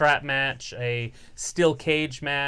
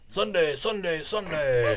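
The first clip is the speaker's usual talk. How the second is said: monster truck ad; 3 semitones higher than usual. Retold or imitated. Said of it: "Sounds like a monster truck rally".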